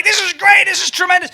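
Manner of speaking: high, loud imitation of excited praise; hammed-up enthusiasm; gushing fans.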